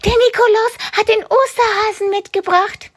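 put-on very high, delighted child's voice; a kid overjoyed by the surprise; excited child. (begeisterte Kinderstimme)

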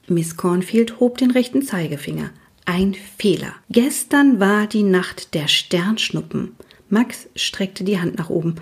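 plain reading voice

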